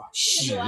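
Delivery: "xi" said in a low Mandarin tone; Mandarin character reading. Shown as a demonstration. Guide shows tour viewers how the character reads, Mandarin tone on "xi". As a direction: pronounce "xi" as a Chinese syllable. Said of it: "In pronunciation, this character reads".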